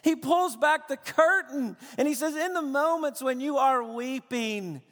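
loud, high, impassioned sermon delivery; preaching at full force. Acted. Pastor pressing the Abba cry on his church, impassioned preaching. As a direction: drive it home to the congregation with fervor.

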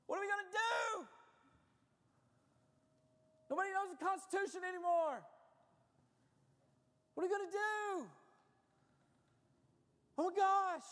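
high, loud panicked wail; mock freaking out. Preacher mimics Christians panicking over politics, freaking out. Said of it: "just freaking out".

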